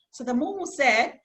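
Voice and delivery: plain reading voice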